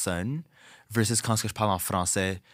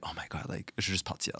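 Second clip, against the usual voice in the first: much softer, lower, under the breath; fed-up mutter; 3 semitones lower, 10 dB quieter than usual. Retold or imitated. Drag performer fed up with an annoying bar patron, quiet aside. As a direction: mutter it to yourself, wanting out. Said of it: "Puis je suis juste like".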